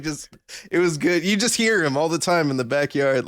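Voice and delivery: ordinary talk, nothing marked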